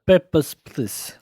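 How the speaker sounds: hammed-up Russian border guard; Russian accent. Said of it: "a Russian accent".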